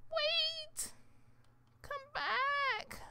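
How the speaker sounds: high, cracking plea for the pet to stay; high pleading cry. Real, not acted. Gamer begging her runaway pet to come back, high pleading cry. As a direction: beg it to come back, near tears.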